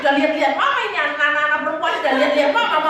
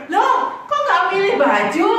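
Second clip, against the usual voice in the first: higher, louder, mock-puzzled seller with a laugh; seller surprised; 7 semitones higher, 5 dB louder, slower than usual. Seller asking why the girls chose no clothes, high and surprised. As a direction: act the seller's amused surprise. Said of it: "Terus yang jual gini".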